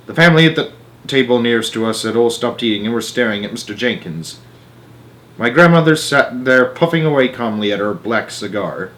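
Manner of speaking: ordinary delivery, nothing marked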